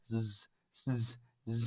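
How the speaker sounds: "জ" as a long buzz between S and G; blended S-G sound. Shown as a demonstration. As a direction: repeat "জ" as a soft zh, held. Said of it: "এরকম একটা উচ্চারণ আসবে".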